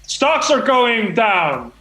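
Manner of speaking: ordinary talk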